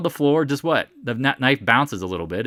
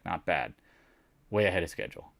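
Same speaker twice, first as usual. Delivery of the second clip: ordinary talk, nothing marked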